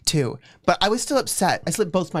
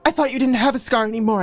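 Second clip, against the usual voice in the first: mild mock shout; 6 semitones higher, 3 dB louder, faster than usual. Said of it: "I shouted".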